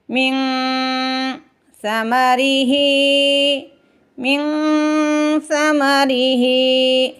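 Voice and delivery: मिन with the nun hidden in the nose before समरिही; nasal ikhfa. Shown as a demonstration. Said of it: "नाक में नून की आवाज़ को छुपाएंगे". (मिन में नून का इख्फा, नाक से)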